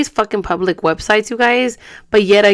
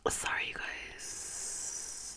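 soft, slow whispered apology; 24 dB quieter, much slower than usual. Real, not acted. Whispering an apology to viewers while someone sleeps. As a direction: breathe it out so nobody wakes.

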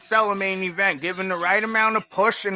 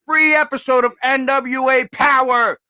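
excited shout; 6 semitones higher, 6 dB louder than usual. Acted. Annonce enthousiaste d'un cadeau.

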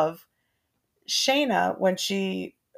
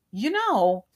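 teasing; 4 semitones higher than usual. Retold or imitated. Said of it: "I was teasing him".

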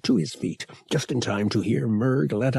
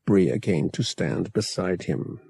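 usual speaking voice

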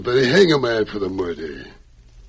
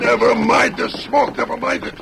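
urgent command; 11 semitones higher, 4 dB louder than usual. Acted. Boss ordering his men through the smoke, urgent command.

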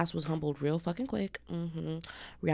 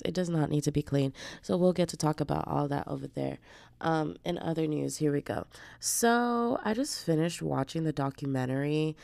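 usual speaking voice

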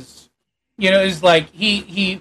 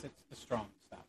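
whispering; 5 semitones lower, 26 dB quieter than usual. Acted. NPC hushed offer.

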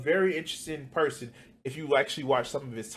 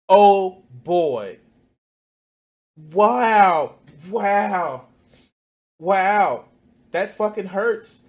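loud, higher, sarcastic wows; mock sympathy; 4 semitones higher, 7 dB louder, much slower than usual. Mocking the rich over a two percent tax, loud sarcastic wows. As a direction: ham up fake pain over a tiny tax.

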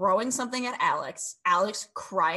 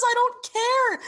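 mocking quote; 7 dB louder, slower than usual. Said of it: "That he says".

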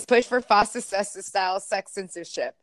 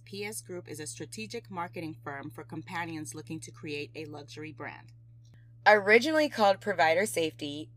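soft, low sponsor ad read; 4 semitones lower, 6 dB quieter than usual. Acted. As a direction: read it like a radio ad. Reads sponsor ad.

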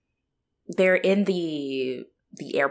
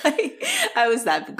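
helpless high laughter breaking up the words; laughing; 6 semitones higher than usual. Real, not acted. Confessing to being that girl, laughing. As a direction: try to talk through giggles.

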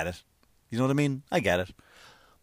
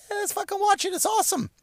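mock viewer enthusiasm; 4 dB quieter than usual. Voices easily pleased clueless viewers.

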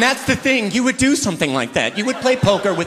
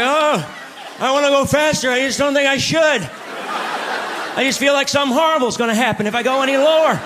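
much higher, fast and anxious; fretting wife; 8 semitones higher than usual. Acted. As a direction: fret like a nervous wife. Old driver's wife voiced.

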